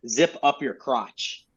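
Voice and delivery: ordinary talk, nothing marked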